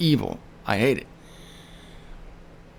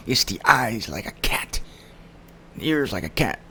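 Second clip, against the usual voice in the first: whisper; 4 semitones lower, 3 dB quieter than usual. Retold or imitated. Whispered aside.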